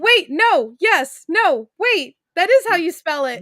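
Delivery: high, loud, choppy backtracking; retold flustered reply. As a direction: stumble over yourself in mock panic. Acting flustered reply to awkward question.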